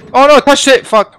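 loud, high burst of alarm; high-pitched, alarmed swearing; panicked yell. Real, not acted. Panics after touching hazard in game.

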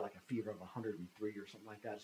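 ordinary talk

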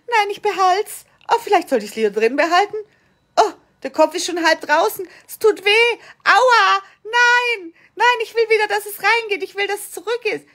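high, loud protest in mock labour; hammed-up panicked pleading, pitched high; acted childbirth panic. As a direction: cry out as if in pain and refusing to push. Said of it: "die schon mal ein Kind geboren haben".

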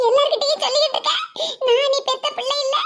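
normal talking voice

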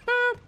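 very high, loud little boop; playful boop. Real, not acted. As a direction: squeak out a short, loud boop. Playful noise while shooting in game.

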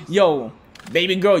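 ordinary talk

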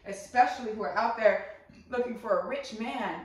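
ordinary talk

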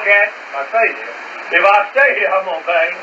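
ordinary talk

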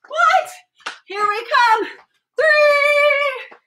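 high, loud excited shouts; excited calling out. Real, not acted. Calling out the last lap.

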